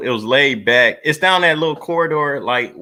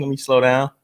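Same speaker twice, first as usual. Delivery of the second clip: normal talking voice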